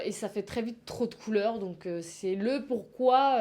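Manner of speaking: usual speaking voice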